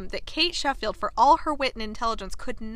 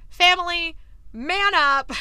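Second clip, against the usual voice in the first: mock rallying call; 7 semitones higher, 5 dB louder, slower than usual. Acts out Anthony rallying his family.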